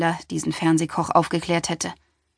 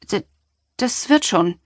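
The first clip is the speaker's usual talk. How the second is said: stammered, higher and louder; stammering; 3 semitones higher, 3 dB louder than usual. A narrator's reading. The doctor stammers, flustered by the patient's confession. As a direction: trip over the first sound, flustered. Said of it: "stotterte ich".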